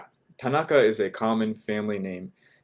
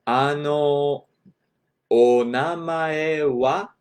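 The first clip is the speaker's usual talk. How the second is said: slow, higher-pitched model phrase, rising on "wa"; slow demonstration; 4 semitones higher, 5 dB louder, much slower than usual. Shown as a demonstration. Teacher repeating the Japanese name question for learners, slowly. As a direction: stretch out each sound of "Ano, onamae wa". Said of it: "I'll say that slowly".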